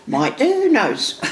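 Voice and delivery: high, slow playful tease; teasing mum. Retold or imitated. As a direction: wind the daughter up, laughing. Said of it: "I went".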